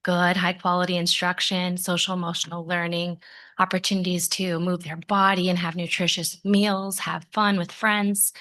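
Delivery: normal talking voice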